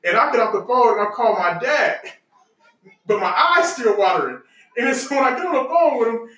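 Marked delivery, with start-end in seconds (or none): none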